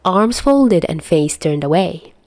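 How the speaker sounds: ordinary delivery, nothing marked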